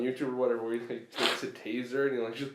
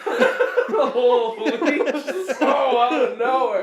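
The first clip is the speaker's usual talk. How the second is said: laughing; 10 semitones higher, 7 dB louder, much slower than usual. Real, not acted. Shocked by joke, laughing.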